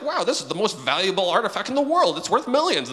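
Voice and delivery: hammed-up excitement, high and loud; amazed appraiser. Appraiser telling the owner it's worth millions, acted amazement. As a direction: build to a big mock reveal.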